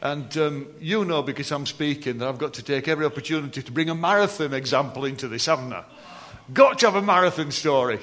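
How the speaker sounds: ordinary delivery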